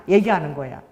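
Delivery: usual speaking voice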